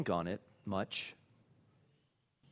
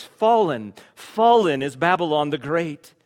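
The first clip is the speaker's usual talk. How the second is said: loud, raised cry of Fallen; mighty cry; 6 semitones higher, 12 dB louder than usual. Acted. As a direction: proclaim like an angel from heaven. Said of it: "he cried out with a mighty voice".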